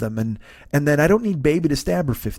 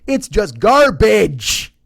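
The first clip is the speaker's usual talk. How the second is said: disgusted; 6 semitones higher, 4 dB louder, much slower than usual. Real, not acted. Ranting about bad movie.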